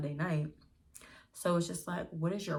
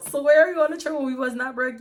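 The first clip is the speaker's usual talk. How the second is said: louder, higher, punching it home; proud loud brag; loud emphatic repeat; 8 semitones higher, 9 dB louder than usual. Real, not acted. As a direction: shout it again for the people in back. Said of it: "And you can finally say".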